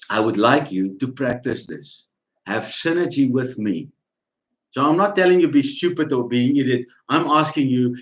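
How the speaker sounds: ordinary talk, nothing marked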